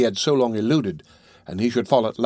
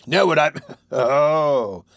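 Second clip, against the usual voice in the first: scornful laugh; 3 semitones higher, much slower than usual. A narrator's reading. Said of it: "cried Sikes".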